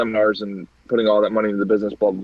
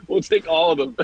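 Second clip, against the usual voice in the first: laughing retelling; 6 semitones higher than usual. Retold or imitated. Retold prank punchline, laughing.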